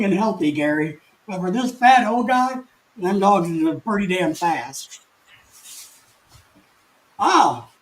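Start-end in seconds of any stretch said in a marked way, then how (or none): none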